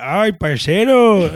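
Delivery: loud, high, drawn-out Colombian paisa greeting; paisa accent. Retold or imitated. Imitating mom playing a paisa, exaggerated accent. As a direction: mimic mom's put-on paisa accent. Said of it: "talking like a paisa".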